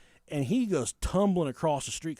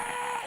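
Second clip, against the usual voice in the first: imitated crowd scream. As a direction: imitate panicked screaming without full volume.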